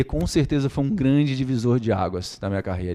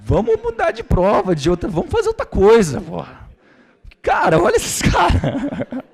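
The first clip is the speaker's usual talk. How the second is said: much higher, laughing and half shouting; doctor laughing at the rivals, pitched way up and loud; laughing exasperated outburst; 4 dB louder than usual. Retold or imitated. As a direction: blurt it out in laughing disbelief, as if the task is hopeless. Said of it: "a minha vontade era olhar pra você e falar assim".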